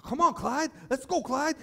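put-on coaxing old man. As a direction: urge the donkey on in a high voice. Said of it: "He's like".

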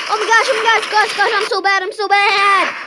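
high, rapid, whiny despair; frustrated wailing. Real, not acted. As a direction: wail over how badly you play. Frustrated at failing in game.

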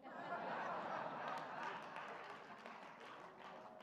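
high breathy burst of laughter. Real, not acted. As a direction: laugh at the story's punchline. Laughing at the punchline.

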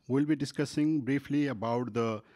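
ordinary delivery

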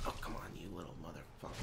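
very soft, low, fast grumble; muttered cursing. Real, not acted. Streamer cursing at game enemy, muttering. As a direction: curse under your breath, quickly.

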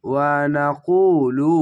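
ওয়া নাকুলু slow, with the কু held for one beat; one alif stretch. Shown as a demonstration. Teacher showing the stretch in ওয়া নাকুলু, slow recitation. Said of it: "এক আলিফ টেনে পড়বেন".

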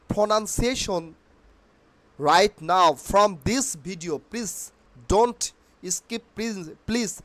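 usual speaking voice

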